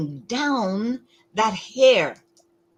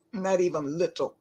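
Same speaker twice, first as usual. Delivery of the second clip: usual speaking voice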